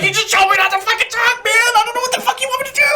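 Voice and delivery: very high, squealed protest; mock exasperated protest. Marine answering contradictory orders, mock exasperated squeal.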